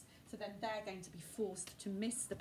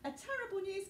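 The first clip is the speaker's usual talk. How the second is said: high, breathless gasp of alarm; a schoolgirl blurting terrible news; Hermione gasping; 9 semitones higher than usual. Acted. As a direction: gasp it out like bad news just arrived. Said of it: "gasped Hermione as she ran".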